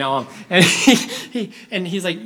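ordinary talk

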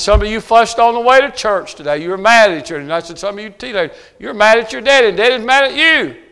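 loud and high, calling out the congregation; retold preaching shout. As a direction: shout it from the pulpit. Retells his own preaching.

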